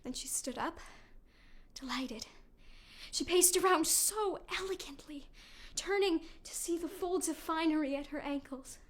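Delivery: low, soft and hushed. Acted. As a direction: draw them in quietly. Calm before the horror.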